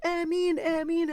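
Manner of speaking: high, fervent chorus response; congregational Ameen. Retold or imitated. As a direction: answer a prayer like a crowd calling back. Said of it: "And they were like".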